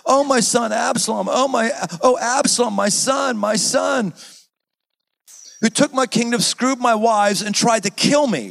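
David's lament over Absalom, understated wail; mild mournful cry. Acted. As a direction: wail for the lost son, lightly. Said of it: "cried out in a loud voice".

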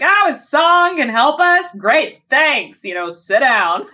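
high, mock-impressed jeer sliding down to dismissal; mock the idea, then wave them off; sarcastic mock reaction. Host voicing the public's scorn for singing actors, sarcastic.

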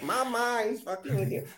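high-pitched mock Chinese accent; lightly voiced Chinese accent; Chinese accent impression. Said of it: "that's the best impression I can do".

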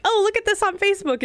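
high, lightly airy quote of a distracted scroller; tossed-off chatter about a phone; phone-distracted partner. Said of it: "don't constantly be like".

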